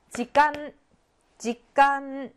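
직간 with a held, pressed final n; marked final n. Shown as a demonstration. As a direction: linger on the closing n of 직간. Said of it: "찍어줘야 돼".

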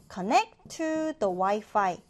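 slow, clear teacher model of connect to the Wi-Fi; slow model phrase. Shown as a demonstration. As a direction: spell it out slowly for learners. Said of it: "teacher models phrase".

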